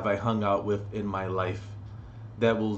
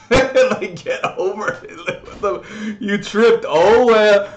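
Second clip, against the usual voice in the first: laughing; 9 semitones higher, 6 dB louder, slower than usual. Real, not acted. Laughing at tripping kid story.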